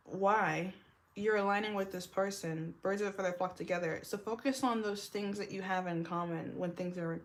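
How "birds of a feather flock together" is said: ordinary talk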